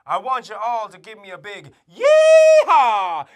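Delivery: loud hyped cowboy call; rallying shout. Acted. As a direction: fire up the crowd. Rallying the kids loudly.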